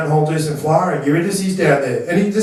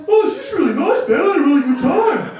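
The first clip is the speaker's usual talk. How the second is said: much higher, shouted mock gush; mock gushing David; 11 semitones higher than usual. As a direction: ham up David gushing about her. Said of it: "he goes, he goes".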